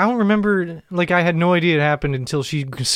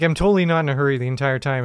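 ordinary talk

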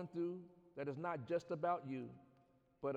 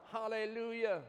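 fiery preaching, louder and higher; 6 semitones higher, 9 dB louder than usual. Acted. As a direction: preach it with rising fire. Climax of sermon shouted.

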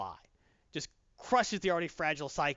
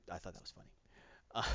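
ordinary delivery

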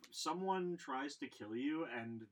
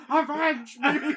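hammy high, slow dying cry for vengeance; loud theatrical last plea, drawn out; overacted death scene; 14 semitones higher, 16 dB louder, slower than usual. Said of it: "You could just hear them on the fucking Kleenex being like".

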